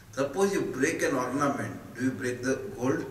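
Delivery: ordinary talk, nothing marked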